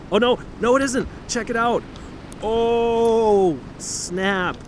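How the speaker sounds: happy surprise; surprised relief. Real, not acted. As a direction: react as the post comes free. Seat post comes loose.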